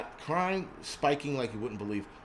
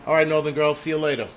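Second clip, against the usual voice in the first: mock gloating politician, louder; gloating de Blasio; 4 dB louder than usual. Mocking the mayor's TV sign-off, gloating and louder. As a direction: lightly ham up a smug sign-off. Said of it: "the guy's gloating every time he goes on T V".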